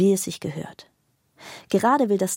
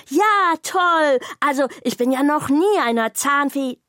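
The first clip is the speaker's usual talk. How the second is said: much higher, louder burst of delight; overjoyed bag; 8 semitones higher, 5 dB louder than usual. A narrator's reading. Bag thrilled Zora will show it her technology, overjoyed. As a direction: squeal it out, thrilled.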